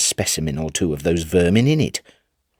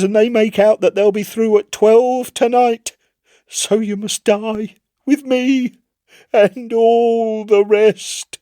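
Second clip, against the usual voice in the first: doom-saying sailor; 12 semitones higher, 3 dB louder than usual. Acted. Chips condemns the Admiral.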